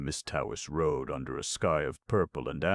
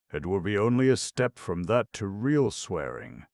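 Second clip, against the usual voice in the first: higher, louder stern warning uncle; 5 semitones higher, 5 dB louder than usual. A narrator's reading. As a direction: lay down the law. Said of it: "said Uncle James sternly".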